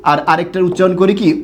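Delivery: normal talking voice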